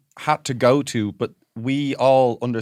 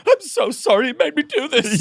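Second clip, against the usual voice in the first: mock crying mum; 12 semitones higher than usual. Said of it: "Is just crying".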